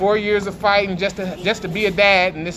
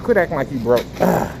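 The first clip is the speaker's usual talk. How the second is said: ordinary delivery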